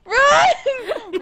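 high, urgent cry; panicked shout. Real, not acted. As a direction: scream at a teammate to flee. Urging teammate to flee.

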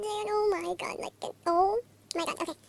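ordinary talk, nothing marked

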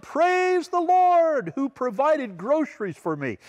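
high, slow, triumphant praise; high, slow, thankful praise; joyful shout. Retold or imitated. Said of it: "She shouted".

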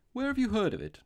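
high, loud startled question; sudden interest. A narrator's reading. Robber questions the priest about the trick, suddenly interested. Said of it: "a sort of sudden interest".

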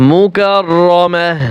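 মুকাররামাহ slowly, closing on breathed h; waqf stop. Shown as a demonstration. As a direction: recite মুকাররামাহ slowly, stopping on "mah". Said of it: "ওয়াকফ করলে কি উচ্চারণ হবে?". (ধীরে, শেষে "মাহ" দিয়ে মুকাররামাহ)

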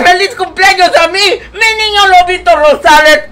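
laughing while reading. Acted. Grandma reading a birthday message aloud while laughing. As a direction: read it out half-laughing, half-crying. Reads chat line through laughter.

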